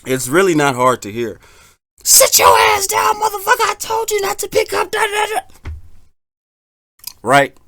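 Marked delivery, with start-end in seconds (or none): 2.0-5.7 yelling mom. Acted. Mom scolding badass kids in an apartment, high shrill yelling. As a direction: scream it like a fed-up mom at the kids. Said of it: "the mom just yells at them".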